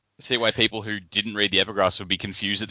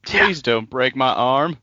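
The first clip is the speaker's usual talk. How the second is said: drawn-out, higher, louder plea; mock pleading; 6 semitones higher, 5 dB louder, slower than usual. Podcaster voicing a victim begging over a broken arm, mock pleading. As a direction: beg in slow mock distress.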